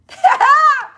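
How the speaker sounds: loud, high squeal of a laugh; high burst of laughter. Real, not acted. Reacting to viewers' flattering comments, loud high laugh.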